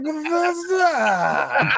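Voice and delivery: stretched high, slow, crying mock yell; mock wailing shout. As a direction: wail the name in over-the-top joy. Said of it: "it's like".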